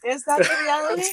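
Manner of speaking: high, loud, teasing; teasing disbelief. Real, not acted. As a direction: poke at the claim playfully.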